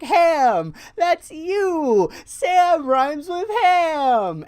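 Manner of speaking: high, very loud, drawn-out holler; imitated gleeful yell. Buddy pointing at another ham, imitated high yell. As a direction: holler it slowly, stretching the words, as the goofy buddy. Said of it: "he's just like".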